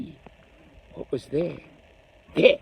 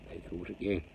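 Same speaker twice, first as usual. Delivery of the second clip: ordinary delivery, nothing marked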